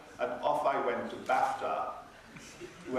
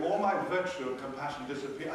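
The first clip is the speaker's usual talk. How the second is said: ordinary talk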